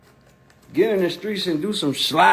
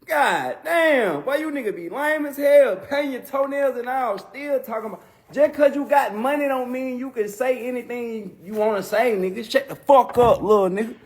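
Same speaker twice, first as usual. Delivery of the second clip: higher put-on voice, scolding and mocking; 7 semitones higher than usual. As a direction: keep ranting as the rapper. Continues [name] impression rant.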